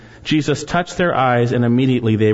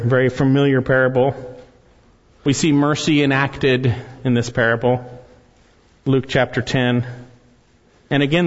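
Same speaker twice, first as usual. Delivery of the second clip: ordinary talk, nothing marked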